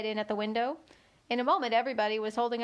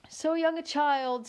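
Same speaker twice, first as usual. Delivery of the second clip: gentleman voice; 5 semitones higher, slower than usual. A narrator's reading.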